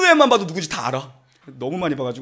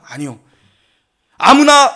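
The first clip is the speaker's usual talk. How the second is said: ordinary delivery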